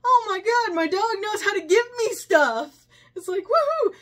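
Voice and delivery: very high, loud thrilled cry; ecstatic owner. Retold or imitated. Owner whose dog brings him stuff, loud ecstatic cheer. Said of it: "And he goes".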